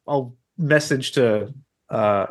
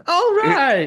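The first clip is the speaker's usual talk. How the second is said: high, laughing mock approval; mimicking old woman. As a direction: echo her in a high voice, amused.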